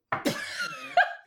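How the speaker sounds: squealing high laugh; high-pitched burst of laughter. Real, not acted. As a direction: crack up at the strange sentence. Laughing at the odd sentence.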